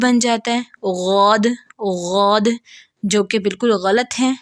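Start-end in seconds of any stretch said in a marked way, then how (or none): 0.8-2.5 غود with a throaty ghain instead of ض; ghain mistake. Shown as a demonstration. Teacher demonstrating the wrong ghain sound. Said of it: "بود کو ہم غین پڑھتے ہیں تو وہ غود".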